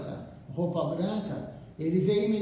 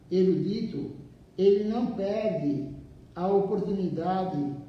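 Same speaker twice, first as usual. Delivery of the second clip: slow, measured reading with pauses between phrases; leitura de livro em voz alta; slower than usual. Acted. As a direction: read the text out deliberately, phrase by phrase. Reads the book aloud to listeners. (leitura pausada, frase por frase)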